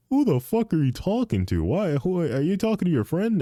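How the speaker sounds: high-pitched imitation of a puzzled dad; questioning dad. Dad asking who his son talks to, high imitated questioning. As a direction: put on dad's voice, pitched well up. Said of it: "he's like".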